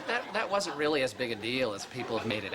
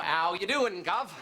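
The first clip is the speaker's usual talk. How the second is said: higher-pitched mock Cockney greeting; Cockney accent; 5 semitones higher than usual. Covering for himself to his boss, practicing a Cockney accent. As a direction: try out an amateur Cockney accent. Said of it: "practicing my Cockney accent".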